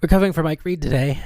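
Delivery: quick, lifted announcer delivery; brisk restart. Acted. Host retaking the intro after composing herself, brisk. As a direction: rattle it off fast and move on.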